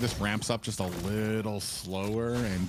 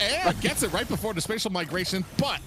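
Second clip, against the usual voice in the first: rising, excited commentary, higher and higher; live play-by-play; 10 semitones higher than usual. Acted. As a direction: call the fight live with building hype. Play-by-play of team fight.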